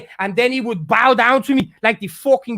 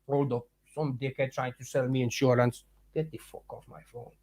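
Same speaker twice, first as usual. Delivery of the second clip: far from the mic, much softer; off-mic aside; 10 semitones lower, 15 dB quieter than usual. Real, not acted. Streamer dealing with an insurance call, off-mic and much softer. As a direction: turn away and mutter at the phone.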